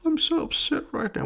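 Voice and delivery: mock blubbering in a high voice; high, hammed-up crybaby imitation; Andrew Garfield. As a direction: whimper it in exaggerated tearful falsetto. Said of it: "you get blubbery fucking crying".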